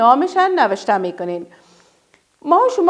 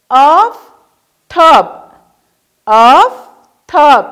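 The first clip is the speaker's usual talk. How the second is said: drawn out and loud, split into syllables; هجا به هجا و آهسته; slow syllable-by-syllable; 7 dB louder, much slower than usual. Shown as a demonstration. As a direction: model the word slowly for children. Teacher modelling syllables.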